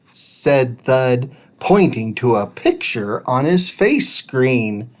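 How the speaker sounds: usual speaking voice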